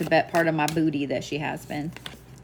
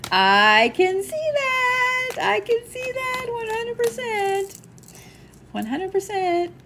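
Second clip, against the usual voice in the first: high, slow, sassy drawn-out agreement; slower than usual. Pretend. Medium reading playfully agreeing the grandmother was sassy. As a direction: ham up the sass. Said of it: "sassy agreement voice".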